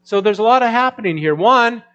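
loud, high fervent preaching; preaching at full force. Acted. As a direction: drive the point home to the congregation. Preaching the point.

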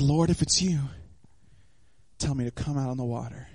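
low, softened, reverent plea; quiet request. Acted. Preacher voicing Peter asking Jesus to call him, hushed. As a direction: say it low and softly, with space between phrases.

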